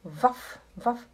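ordinary talk

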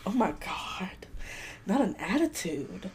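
breathy hushed shock at his attitude; whispered reaction. Retold or imitated. Shopper whispering shock at a rude man's attitude. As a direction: whisper it like gossip.

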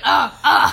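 loud mock baby grunts; teen mimicking her baby self grunting at night. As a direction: grunt like a baby gripping the crib. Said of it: "'Cause I made him, like".

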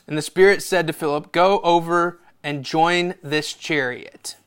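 loud, proclaiming Bible reading; declamatory Scripture reading. A narrator's reading. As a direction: proclaim the verse to the church. Reads Scripture aloud.

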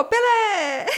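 high mock cheer with a laugh; mock name-shouting. Retelling the cab's football name game, high laughing mock cheer. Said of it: "Uma gritaria de nome".

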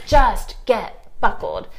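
slow, stretched-out plea at the end of patience; retold exasperated order to a dawdling kid; exasperated parent. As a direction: drag out each word in mock exasperation. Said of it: "totally am losing my mind".

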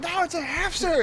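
high-pitched pleading protest; mock-panicked explanation at normal volume; retold panicked denial. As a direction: re-enact a kid caught out, voice shooting up.

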